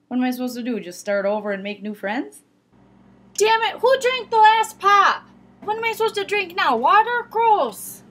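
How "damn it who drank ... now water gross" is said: high, loud, slow complaint; annoyed whine. Acted. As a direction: whine about the missing pop. No pop left, outraged.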